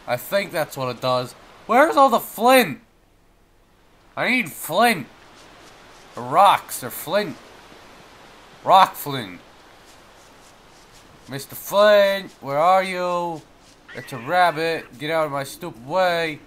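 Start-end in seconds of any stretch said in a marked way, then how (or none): 1.7-13.4 frantic search. Real, not acted.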